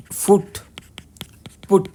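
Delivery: फुट and पुट with different vowel sounds; English vowel contrast. Shown as a demonstration. Teacher contrasting English spellings, words set apart. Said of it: "दो, दो उच्चारण है".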